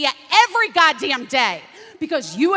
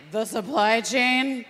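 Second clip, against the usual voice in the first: puzzled question; 5 semitones lower, 4 dB quieter, slower than usual. Retold or imitated. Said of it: "And then I was like".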